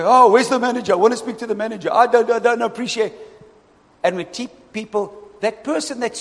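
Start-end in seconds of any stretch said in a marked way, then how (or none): 0.0-3.1 higher, fast indignant customer asking for the manager; huffy customer. Retold or imitated. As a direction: play an offended diner complaining. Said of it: "You say".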